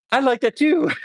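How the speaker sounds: very high, comic eager reply; mock eagerness. Paramedic agreeing with the patient about sedation, playfully eager. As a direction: squeak it up high as a punchline.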